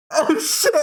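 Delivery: high, giggling realization; laughing oh shit. Retold or imitated. Remembering she left her brother, high giggling. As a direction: catch yourself mid-laugh. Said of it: "I was like".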